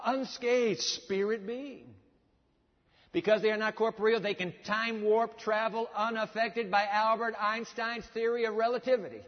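high, driving sermon delivery; impassioned preaching. Real, not acted. Preacher tells congregation of angels unharmed in flame, impassioned preaching. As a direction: press each example home with rising fervor.

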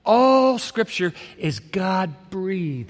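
a second very slow reading, each word weighed; slow, savored. Shown as a demonstration. Preacher repeats the verse to the congregation, slow and weighty. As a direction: repeat it slowly so it lands. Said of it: "savor that thought for a moment".

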